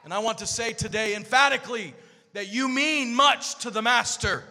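loud, rising preacher's cry, half-sung; full-force preaching. Real, not acted. Pastor to congregation about God's love, loud sung preaching. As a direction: build it up until you're shouting to the church.

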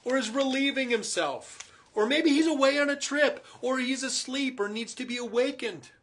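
high, loud mocking taunt; scoffing. Elijah mocking the prophets of Baal, high scoffing taunt. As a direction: sneer at the prophets. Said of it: "he scoffed".

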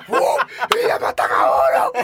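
loud, hammed-up whoop; mock outcry. Guest acting out the pool scare, mock outcry. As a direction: act out a loud, breathy mock alarm. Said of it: "saca todo ese óxido para arriba, así".

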